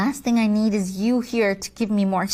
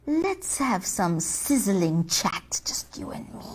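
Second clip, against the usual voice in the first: seductive; 6 semitones lower, 3 dB quieter than usual. Acted. Devil flirting low.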